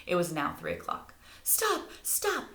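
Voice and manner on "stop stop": put-on high shout after a baby carriage. A narrator's reading. Morty yelling at the mother ahead, high shout. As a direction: call out to stop them. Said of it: "shouted Morty".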